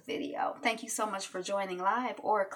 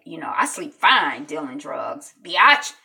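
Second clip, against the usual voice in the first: cocky; 4 semitones lower, 8 dB louder than usual. Retold or imitated. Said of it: "the boy is like".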